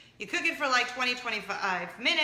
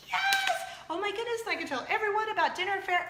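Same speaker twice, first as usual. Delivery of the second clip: high squealed yes, then excited rush; thrilled acceptance; 10 semitones higher than usual. Retold or imitated. Host accepts friend's sponsorship offer, thrilled and squealing. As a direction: burst out with delight.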